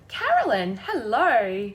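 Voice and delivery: ordinary talk, nothing marked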